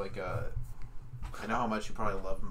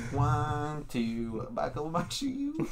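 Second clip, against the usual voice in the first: drawn-out, higher-pitched put-on counting rhyme; mock meme voice; 7 semitones higher, much slower than usual. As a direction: recite it in a silly high voice.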